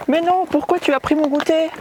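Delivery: put-on high whiny little boy; a kid complaining about his snack. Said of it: "tu peux faire une voix de petit garçon".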